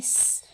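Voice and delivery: س held as a slow hissing whistle; whistling s. Shown as a demonstration. Teacher contrasting soft letters with a whistling hiss. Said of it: "سیٹی کی آواز آئی تھی".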